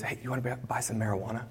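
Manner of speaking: imitated street dealer, very soft and low-key; furtive hushed offer. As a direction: slip the offer over secretly, barely audible.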